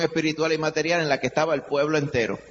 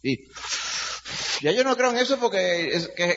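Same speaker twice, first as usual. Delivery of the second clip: scoffing skeptic in a high voice; mocking unbeliever; 9 semitones higher than usual. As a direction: mimic a dismissive skeptic. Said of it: "muchas personas dicen".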